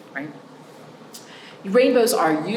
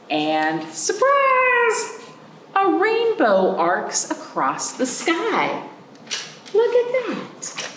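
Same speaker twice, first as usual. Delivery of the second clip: much higher, slow delighted wonder; storybook wonder, pitched way up; excited reveal; 14 semitones higher, much slower than usual. Acted. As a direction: gasp it out like showing kids a surprise.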